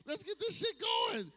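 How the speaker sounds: high-pitched indignant rant, slowing at the end; mock outrage. Man scolding a dead vibrator, mock outrage. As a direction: act scandalized that the toy isn't charged. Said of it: "I be like".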